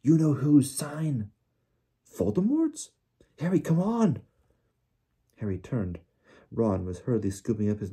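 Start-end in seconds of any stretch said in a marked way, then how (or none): 0.0-4.2 high, urgent character voices; urgent alarmed cry. A narrator's reading. Frightened friends urging Harry to flee, alarmed.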